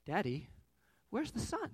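small voice lifting into a question; imitated young son. Son asks Daddy on waking, lightly voiced child. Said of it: "Ethan wakes up, he's like".

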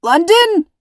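desperate shout, high and loud; father yelling to his captured daughter. Acted. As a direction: call her name across the beach. Dad calls out to daughter.

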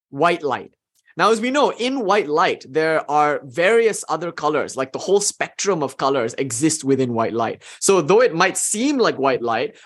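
ordinary talk

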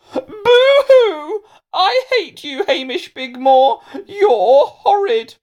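very high, loud mock crying; hammed-up wailing. Melanie wails at Hamish for insulting her, mock sobbing. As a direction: wail like an offended child, dragging the words out. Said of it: "she wailed".

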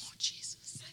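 whispered "Oh, Jesus" under her breath; exasperated whisper. Retold or imitated. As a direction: sigh it out in a whisper. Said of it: "I'm like".

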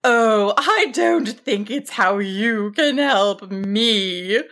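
slow, big dragon voice; kindly giant, drawn-out words; kind, loud dragon. A narrator's reading. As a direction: correct gently but in a big voice. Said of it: "the dragon corrected kindly, if loudly".

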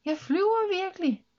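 high, thrilled exclamation; joyful cry, pitched well up; happy shout. A narrator's reading. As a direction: marvel out loud at flying. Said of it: "råbte hun glad".

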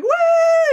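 sudden very high, loud whoop; a gleeful superhero whoop; mock cheer. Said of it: "he wouldn't be going around going".

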